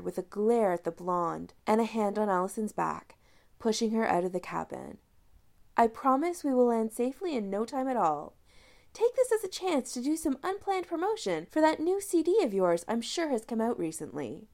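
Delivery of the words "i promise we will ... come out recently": perky British lilt, pitched up. A narrator's reading. Woman cheerfully pushing Allison back to passengers, perky. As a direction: sell it brightly, a touch of British accent. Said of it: "she suggested perkily".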